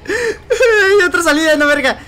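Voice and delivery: high laugh-crying; half laughing, half whimpering while fleeing; laughing panic. Real, not acted. Streamer chased in game, panicked laughing cry.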